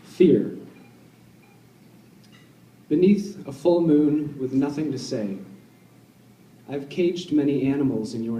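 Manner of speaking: measured, deliberate poem recital with long pauses; poetry reading. Acted. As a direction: read it line by line, letting each image hang. Reads own poem aloud.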